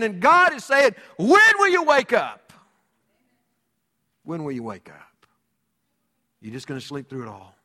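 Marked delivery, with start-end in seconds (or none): 1.2-2.5 high, loud cry to rouse sleepers; thundering rebuke. Acted. God's rebuke, preached at full force. 4.2-7.6 low, soft, grave question; hushed rebuke. Acted. Rebuke repeated in a hush.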